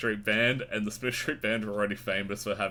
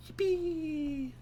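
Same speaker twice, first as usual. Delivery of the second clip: mock cheer; 4 dB quieter than usual. Sarcastic cheer at failed search.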